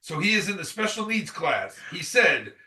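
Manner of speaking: usual speaking voice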